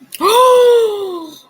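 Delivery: a sharp, loud gasp of shock. Real, not acted. Reacting to a photo, a loud shocked gasp.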